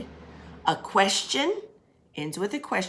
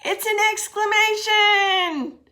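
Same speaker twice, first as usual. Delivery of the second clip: excited answer; 14 semitones higher, 6 dB louder, slower than usual. Shown as a demonstration.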